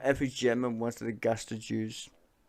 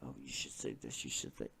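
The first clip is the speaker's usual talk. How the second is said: much lower and softer, sly adviser murmur; mock PR whisper; 13 semitones lower, 17 dB quieter than usual. Streamer mimicking PR advisers feeding lines, sly murmur.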